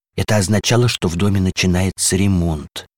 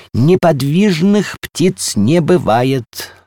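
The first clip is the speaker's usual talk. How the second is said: higher, slow and insistent objection; emphatic contradiction; 8 semitones higher, 4 dB louder, much slower than usual. A narrator's reading. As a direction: contradict firmly, drawing the words out. Column flatly contradicts the little balcony. (категоричное возражение)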